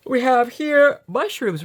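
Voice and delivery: slow words stretched by a yawn. Real, not acted. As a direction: speak through a yawn. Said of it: "I'm yawning".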